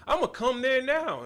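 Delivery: high, loud mock angry woman; hammed-up threat from an upset client; imitating the angry client on the phone.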